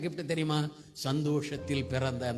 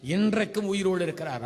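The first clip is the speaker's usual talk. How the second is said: higher, forceful preaching; emphatic proclamation; 4 semitones higher, 5 dB louder than usual. Real, not acted. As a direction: ring it out to the congregation. (உரத்த விசுவாச அறிக்கை)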